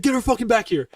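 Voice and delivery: mock irate order; imitated angry order. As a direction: bark it like the staff sergeant. Staff sergeant ordering her back.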